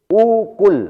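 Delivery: ukul said high and pushed, both vowels rounded, as a hard word; careful pronunciation. Shown as a demonstration. Teacher showing the class a hard Arabic word, carefully pronounced.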